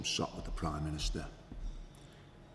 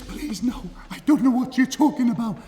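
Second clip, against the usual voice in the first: terrified pleading; 10 dB louder than usual. Retold or imitated. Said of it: "He says".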